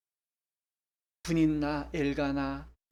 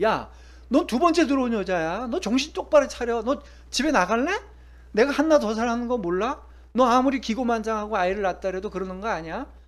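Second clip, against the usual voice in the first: louder and higher, scolding hard; sharp rebuke; 7 semitones higher, 8 dB louder than usual. Pretend. Husband scolding the second wife, loud and stern. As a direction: put her firmly in her place. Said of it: "분인나를 따끔하게 뭐라 그러든지".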